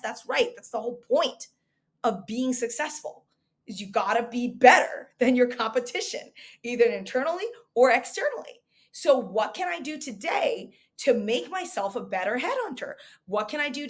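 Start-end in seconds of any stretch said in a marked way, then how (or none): none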